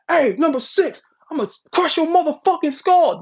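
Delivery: mock field yell at a player; football scream. Player yelling at number six on the field, mock yell. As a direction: bark it like a linebacker. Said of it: "when they scream out".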